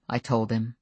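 ordinary delivery

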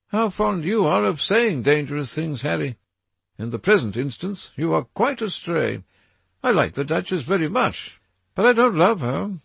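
put-on a nervous young man seeing a threat in the trees; frightened Dorian. A narrator's reading.

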